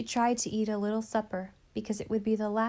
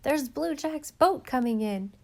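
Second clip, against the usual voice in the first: higher, louder excited call; excited exclamation; 5 semitones higher, 4 dB louder than usual. A narrator's reading. Child spotting Blue Jack's boat, excited call. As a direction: call out the news. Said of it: "someone exclaimed".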